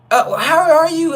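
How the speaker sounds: high and slow, sing-song flirty greeting; flamboyant inmate voice. Retold or imitated. Inmate coyly greets narrator, slow high sing-song. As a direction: coo it through the fence.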